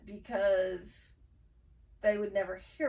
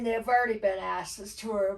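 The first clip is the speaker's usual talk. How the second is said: ordinary talk, nothing marked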